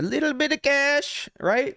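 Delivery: jokey high voice; squeaky mock voice. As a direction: squeak it like a cartoon.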